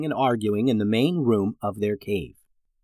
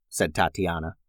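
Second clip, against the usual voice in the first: ordinary delivery, nothing marked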